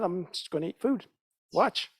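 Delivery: ordinary delivery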